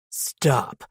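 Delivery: low, commanding man's voice; deep demanding voice. A narrator's reading. Man to the woman struggling under him, deep demand. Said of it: "the deep voice demanded".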